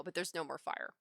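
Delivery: usual speaking voice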